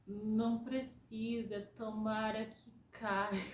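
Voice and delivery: drawn out, slow, half-sedated; very slow groggy speech. Retold or imitated. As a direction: stretch each word like someone barely awake. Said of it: "Porque eu tava falando muito devagar".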